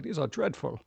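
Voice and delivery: soft, sniffy snob complaint; snooty disdain. Retold or imitated. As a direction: turn your nose up at it. Snobs complaining about food.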